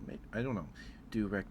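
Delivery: ordinary talk, nothing marked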